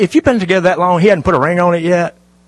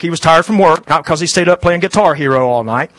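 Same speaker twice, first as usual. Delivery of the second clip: ordinary talk, nothing marked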